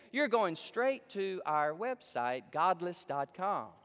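slow deliberate ad tag; slow punchline. Retold or imitated. Preacher delivering the ad's punchline slowly. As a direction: draw it out like a reveal. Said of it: "He goes".

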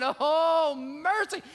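high, loud frightened gasp of a plea; scared out of his mind. Retold or imitated. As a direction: cry out in fright at the edge. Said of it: "He's just scared out of his mind".

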